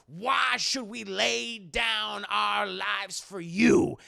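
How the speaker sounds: slow, drawn-out put-on oratory; hammed-up, stretched-out declamation; Charles Dutton. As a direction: challenge them like a rousing speechmaker. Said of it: "Charles Dutton's like".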